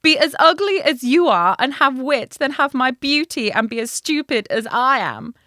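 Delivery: high, loud cried princess line; cartoon princess. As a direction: cry it out like a storybook princess. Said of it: "cried the princess".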